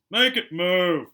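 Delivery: slow, loud drawn-out whine; a spoilt boy whining to be obeyed. A narrator's reading. Dudley whines at his father to make the snake move. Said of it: "he whined at his father".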